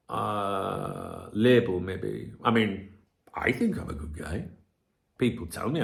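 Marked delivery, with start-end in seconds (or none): 3.3-4.5 hushed, softer admission; whispered reflection. Real, not acted. Vlogger doubting himself, hushed whisper. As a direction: say it almost to yourself.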